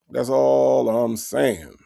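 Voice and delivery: slow, drawn-out "That's all I'm saying"; slow emphatic repeat. Shown as a demonstration. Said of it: "repeats his point slower".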